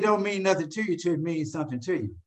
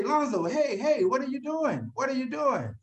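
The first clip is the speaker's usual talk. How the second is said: higher-pitched, urgent inner voice; voice of reason; 5 semitones higher than usual. Acted. His conscience stopping him chasing with a bat, pitched-up pleading. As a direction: imitate reason calling him back, pitched up. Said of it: "the bridge of reason say".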